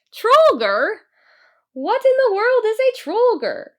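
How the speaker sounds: very high, loud incredulous squeal; mocking leprechaun. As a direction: scoff at the silly name. Laughing leprechaun mocks word.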